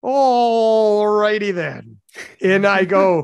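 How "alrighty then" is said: stretched-out comic catchphrase, pitched up; long drawn-out first word, cartoonish; Ace Ventura. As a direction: do a hammy movie catchphrase, very slow. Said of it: "Is it a quote from, like, Ace Ventura".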